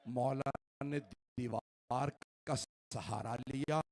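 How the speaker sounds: ordinary delivery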